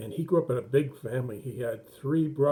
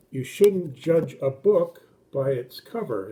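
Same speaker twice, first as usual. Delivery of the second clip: teaching an expression; 5 dB louder, slower than usual. Shown as a demonstration. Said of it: "we have an expression in English".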